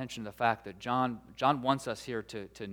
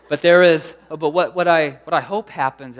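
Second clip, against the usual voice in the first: loud restart; 4 semitones higher, 12 dB louder than usual. Real, not acted. Loud restart after the joke.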